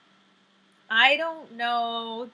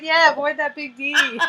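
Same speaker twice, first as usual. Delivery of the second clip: loud, giggly mock advice; laughing mock advice; 5 semitones higher, 7 dB louder than usual. Repeating the fishmonger's joke, loud and laughing. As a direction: echo the joke through laughter. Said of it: "it's like".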